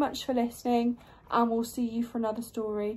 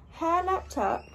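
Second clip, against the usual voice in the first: ordinary delivery, nothing marked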